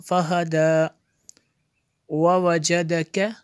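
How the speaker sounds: measured, resonant teaching recitation; word-by-word recitation. Shown as a demonstration. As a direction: chant each word separately with held vowels. (एक-एक लफ़्ज़ लय में पढ़ना)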